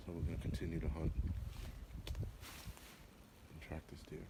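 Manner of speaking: hushed whisper so nothing spooks. Real, not acted. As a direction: keep it to a low breathy whisper. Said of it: "sorry for whispering".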